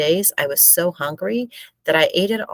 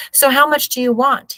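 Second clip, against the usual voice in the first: curt; 9 semitones higher, 5 dB louder than usual. A narrator's reading. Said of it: "not even looking up".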